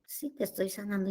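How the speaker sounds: soft, low imagined reply; answering herself. Retold or imitated. Woman answering herself as if the Lord spoke, hushed. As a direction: answer your own prayer quietly and low. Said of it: "yo sola me contestaba".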